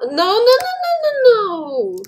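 loud, high rapid-fire repeated no; panicked protest. Real, not acted. Player losing the race to teacher, panicked protest. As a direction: pitch climbs into a frantic wail.

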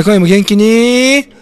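「こんばんは」を高く大きく長く伸ばす; 元気いっぱいに声を張り上げて、ゆっくり挨拶; 元気な挨拶. Shown as a demonstration. (ラジオ番組の元気な呼びかけ)

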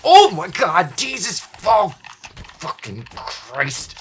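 high, loud frightened yell; terrified scream. Real, not acted. Gamer screaming as a monster appears. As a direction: scream in real panic. Jump scare in horror game.

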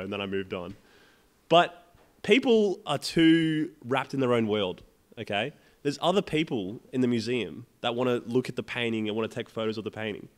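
normal talking voice

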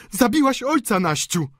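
high, loud horrified daughter; shrieked accusation. A narrator's reading. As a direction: hurl blame at the sister. Delphine accuses her sister.

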